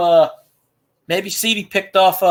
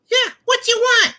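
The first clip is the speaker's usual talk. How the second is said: very high, put-on work phone voice; mock-rude prank pickup; disguised phone voice. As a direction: answer in a silly high-pitched voice. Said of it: "I pick up the phone and I go".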